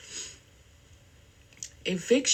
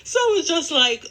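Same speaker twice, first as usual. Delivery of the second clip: laughing; 12 semitones higher, 7 dB louder, faster than usual. Real, not acted. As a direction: still laughing, much higher and louder. Laughing at exploded dye bottle.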